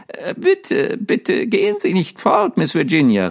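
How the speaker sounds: high pleading cry of a lonely ghost; pleading call. A narrator's reading. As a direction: call after her not to leave. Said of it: "rief der Geist".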